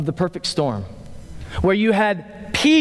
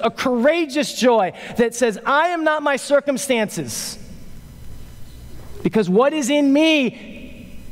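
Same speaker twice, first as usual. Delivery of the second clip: impassioned preaching; 6 semitones higher than usual. Real, not acted. Preacher urging the congregation toward courageous joy, impassioned. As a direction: preach it with rising, higher pitch.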